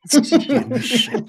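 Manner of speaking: slow, high, laughing curse; laughing frustration. Real, not acted.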